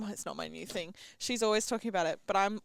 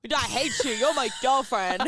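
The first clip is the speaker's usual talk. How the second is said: imitated exasperated outburst; exasperated boyfriend; 3 semitones higher, 6 dB louder than usual. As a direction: protest in hammed-up disbelief, dragging the ending. Repeats boyfriend's exasperation on request.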